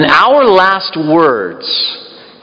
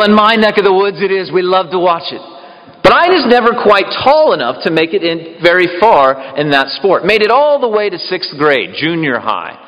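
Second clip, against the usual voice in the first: ordinary delivery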